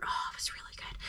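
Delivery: breathy whispered delight. Real, not acted. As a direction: whisper it like a secret treat. Savoring the donut.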